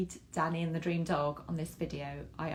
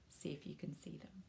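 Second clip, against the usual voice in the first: whispered aside; 16 dB quieter than usual. Real, not acted. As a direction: lean in and whisper the hint. Aside to children about picture.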